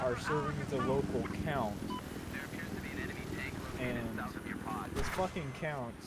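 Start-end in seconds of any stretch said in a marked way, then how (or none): none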